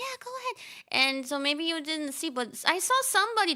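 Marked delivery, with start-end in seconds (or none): 0.1-0.6 high, bright quoted yes; mimicking Kone. Kone gives streamer permission, high mimicking voice. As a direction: echo her easy permission up high. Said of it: "She's like".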